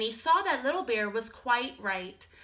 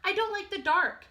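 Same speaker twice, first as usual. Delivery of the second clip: higher small cub voice; a tiny scared cub, pitched up; little bear cub; 10 semitones higher than usual. Acted. Little Bear tells Big Bear he is scared, high small voice.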